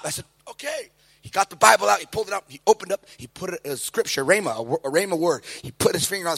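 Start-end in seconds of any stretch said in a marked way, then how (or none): 0.5-0.9 meek okay. Retold or imitated. Meek reply to command.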